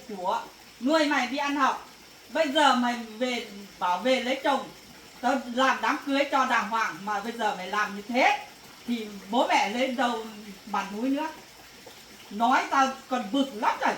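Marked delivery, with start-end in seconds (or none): none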